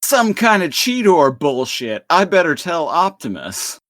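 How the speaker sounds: high-pitched, loud excitable cartoon cat; Cheetor. Cartoon cat spots a jacked flier overhead, high excitable exclaiming. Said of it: "And Cheetor is all like".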